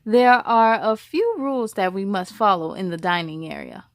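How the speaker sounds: lively storybook reading voice; story narration. A narrator's reading. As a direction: read it like a teacher to a class. Reads story to children.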